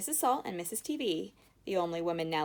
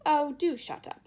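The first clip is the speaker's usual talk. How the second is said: irritated; 8 semitones higher, 6 dB louder than usual. A narrator's reading. Mr Wonka snaps at Mrs Salt.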